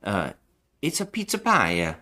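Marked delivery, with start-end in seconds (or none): 0.8-1.7 hammed-up Italian accent, higher; mock Italian pizza-man accent. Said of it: "he's doing that voice".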